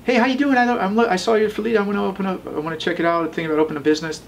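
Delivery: high, bright-voiced wife on the phone; wife calling a landlord, in a high put-on voice; imitating wife. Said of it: "my wife would call".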